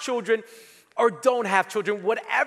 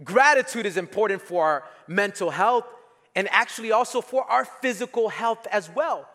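ordinary delivery

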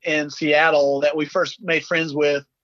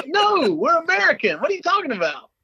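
indignant disbelief; 14 semitones higher than usual. Retold or imitated. Retold reply to Seattle locals.

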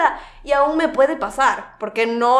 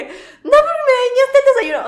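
retold yell; 5 dB louder than usual. Her shouting back at brother.

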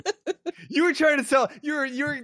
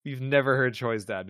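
usual speaking voice

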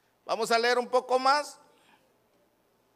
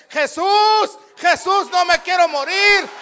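high, loud plea for life; urgent full-voiced cries; desperate shouting; 7 semitones higher, 6 dB louder, slower than usual. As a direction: scream for rescue as if dying. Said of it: "hasta que grites".

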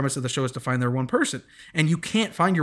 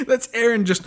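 laughing; 8 semitones higher, 3 dB louder than usual. Real, not acted.

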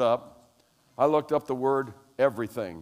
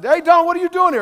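mock surprised greeting; 14 semitones higher, 9 dB louder than usual. Greeting Don retold.

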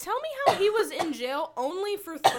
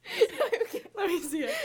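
laughing; 9 semitones higher, slower than usual. Real, not acted. Laughing while grabbing the word.

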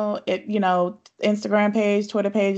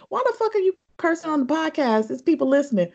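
higher-pitched mock mom scolding; imitating mom; 7 semitones higher than usual. Guest imitating her mother scolding her about cursing. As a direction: play the mom calling out her curse words. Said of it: "That's exactly how she would say it".

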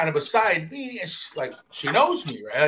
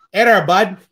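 loud, hammed-up Canadian brush-off; mock Canadian accent; 3 semitones higher, 10 dB louder than usual. Telling a man to back off, mock Canadian accent. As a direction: put on a cartoon Canadian accent. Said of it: "on some Canadian shit".

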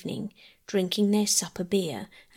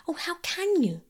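high upset cry; 10 semitones higher than usual. A narrator's reading. Phyllis cries out to Peter, shocked and pleading. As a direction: pitch jumps up in dismay. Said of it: "cried Phyllis".

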